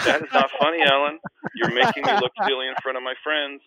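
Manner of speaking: high, loud mock indignation; indignant protest. To prankster teammate in front of friends, indignant.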